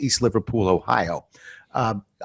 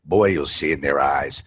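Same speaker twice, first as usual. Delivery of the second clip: put-on low, terse old salesman voice; gruff old mentor; 9 semitones lower than usual. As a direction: drop way down like a crusty mentor.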